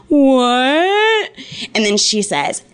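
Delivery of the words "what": higher, dazed what after a punch; stunned what. Retold or imitated. Stunned after being punched, a dazed higher what. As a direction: react as if just hit. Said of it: "just stood there and was like".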